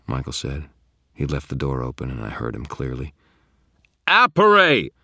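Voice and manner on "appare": loud, high command; grated incantation. A narrator's reading. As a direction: force the spell word out. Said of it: "I grated out".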